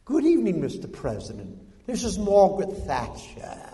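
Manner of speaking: mock British prime minister, starting high and falling; put-on British phone greeting with swooping pitch; Margaret Thatcher. Prime minister greets the president by phone, put-on British. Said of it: "It went something like this".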